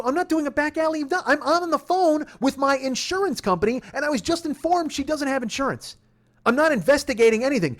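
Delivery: high-pitched, insistent self-defence; indignant protest. Retold or imitated. Driver denying a back alley investigation, indignant. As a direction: defend yourself in high outrage.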